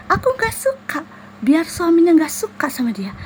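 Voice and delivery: high whispering spirit; spiteful jinn. Retold or imitated. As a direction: squeak it out, then hiss the spite.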